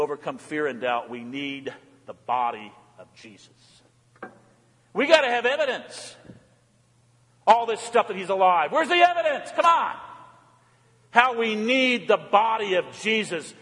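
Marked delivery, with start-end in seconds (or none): 4.9-13.5 raised, urgent sermon delivery; impassioned preaching. Real, not acted. Preacher pleading the need for Jesus, impassioned. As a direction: build insistently on each repeated phrase.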